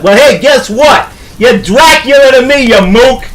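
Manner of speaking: indignant New York-style jeer; scornful outburst. Acted. Wolfman mocks his rival.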